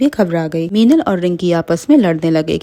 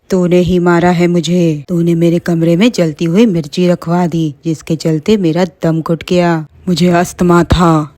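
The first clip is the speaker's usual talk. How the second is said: accusing witch. Acted. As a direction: blame her coldly. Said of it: "Mona चुड़ैल कहने लगी".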